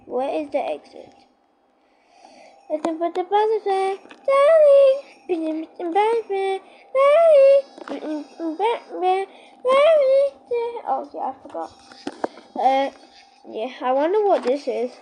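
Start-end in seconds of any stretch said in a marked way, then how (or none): 2.7-10.3 diva voice. Acted. Sing-song voice with darling.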